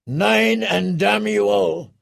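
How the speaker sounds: high, drawn-out war cry; battle shout. A narrator's reading. Grimtongue behind her in the fight, shouting defiance. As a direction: shout it across the battle, stretching the words. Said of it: "Grimtongue shouted".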